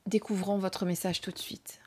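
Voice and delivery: hushed whisper, eyes closed. Real, not acted. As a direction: whisper as if in a trance. Eyes closed, connecting to card.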